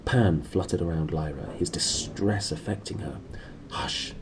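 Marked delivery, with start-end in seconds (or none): 3.7-4.1 soft, hushed word; quiet hush to a frightened daemon; whispered hush. A narrator's reading. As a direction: hush her gently, barely voiced. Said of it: "she whispered".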